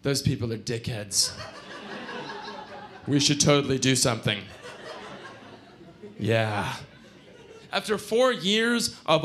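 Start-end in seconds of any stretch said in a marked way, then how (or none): 0.0-4.4 deadpan grumbling. Acted. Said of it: "Will and Dago and I saying". 6.2-6.7 half-hearted agreement. Acted. Friends' idle agreement, acted.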